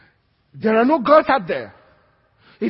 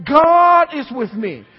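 slow, louder, higher declaration; slow proclamation; 5 semitones higher, 7 dB louder, slower than usual. Shown as a demonstration. Preacher leads congregation's repeat, slow loud proclamation. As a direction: stretch each word out for the room. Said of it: "Say it with me".

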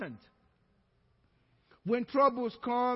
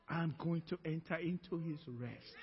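low, soft declaration; calm resolve; 5 semitones lower, 8 dB quieter than usual. Acted. Believer refusing Satan's ministry, quietly resolute. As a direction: drop to quiet, settled assurance.

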